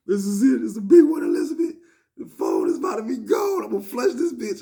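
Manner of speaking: loud, high, wailing mock despair; hammed-up panic. Inmate about to flush the phone, hammed-up panic. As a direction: cry out as if all is lost.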